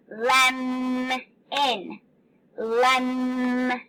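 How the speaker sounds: lemon with the M held long, lemmm-on; held-out sound. Shown as a demonstration. Teacher holding out the M in lemon for kids. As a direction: stretch the M in lemon. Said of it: "We were holding that M out".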